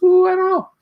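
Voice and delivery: high falsetto ooh of doubt; doubtful ooh. Retold or imitated. Reacting to the troll's super chat, squeaky falsetto doubt. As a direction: squeak it out, unsure. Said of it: "I'm like".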